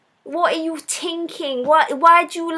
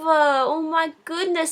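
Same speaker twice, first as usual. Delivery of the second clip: usual speaking voice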